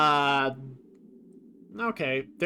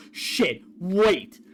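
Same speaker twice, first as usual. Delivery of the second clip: mildly panicked, louder on the last word; imitated panic, only half played; mock panic; 4 dB louder than usual. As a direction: act out a touch of alarm, punching the final word. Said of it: "they're panicking".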